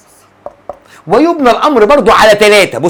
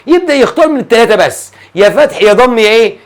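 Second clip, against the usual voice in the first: ordinary talk, nothing marked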